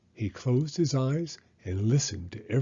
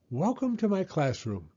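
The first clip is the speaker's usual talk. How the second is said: high little bird voice; welcoming bird; 6 semitones higher than usual. A narrator's reading. Bird teacher welcomes Rocket to class, high chirpy. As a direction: welcome the pupil brightly.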